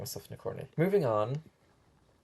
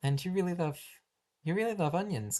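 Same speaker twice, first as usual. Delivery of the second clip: ordinary talk, nothing marked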